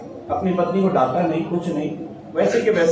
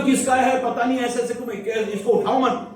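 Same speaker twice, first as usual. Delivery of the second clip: higher, scoffing, with a laugh; dismissive wife; 6 semitones higher than usual. Acted. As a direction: brush the idea off like a scornful wife. Wife scoffs at taking the baby. (तिरस्कार भरी हँसी के साथ ऊँची आवाज़)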